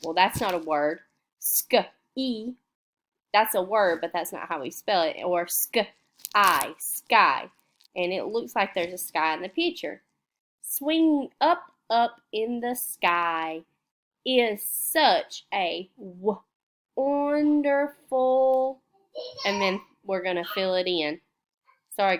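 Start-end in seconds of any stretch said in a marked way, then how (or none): 1.4-2.5 sk then e, split apart; sounded out. Shown as a demonstration. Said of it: "we could even sound that out". 5.5-7.5 sk, I, then blended into sky; sounded out. Shown as a demonstration. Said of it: "we could even sound that out". 10.6-18.8 slow, high, sing-song reading; slow read-aloud. Shown as a demonstration. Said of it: "let's read this story".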